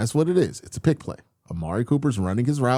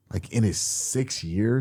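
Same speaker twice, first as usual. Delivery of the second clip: ordinary delivery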